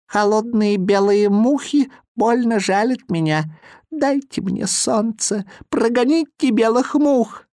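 put-on high, pleading child voice; pleading boy. A narrator's reading. Boy freezing on snowy peak begs for sun, pleading. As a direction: beg for the sun. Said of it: "жаловался негритёнок".

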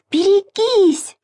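very high, drawn-out cry of alarm; squealed warning. A narrator's reading. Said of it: "визжала Маша". (протяжно, визгливо предупреждает)

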